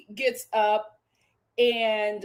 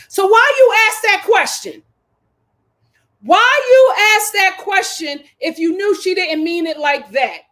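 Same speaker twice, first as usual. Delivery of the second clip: indignant call-out; 5 semitones higher, 8 dB louder than usual. Real, not acted. Confronting Clark indignantly.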